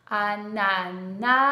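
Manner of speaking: "Ananas" loud and slow, a-na-nas; syllable by syllable. Shown as a demonstration. Teacher helping a child count the A sounds, syllable by syllable. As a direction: sound out "Ananas" with each syllable held long. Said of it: "décomposez bien le mot en syllabes".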